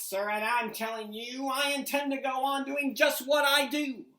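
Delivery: the Onceler shouting down his critic; yelling Onceler. Acted. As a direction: yell back at the Lorax defiantly. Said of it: "I yelled at the Lorax".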